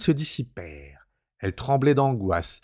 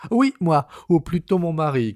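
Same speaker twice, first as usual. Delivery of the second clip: cousine's voice; 6 semitones higher, 4 dB louder than usual. A narrator's reading. Cousine insists, voiced higher.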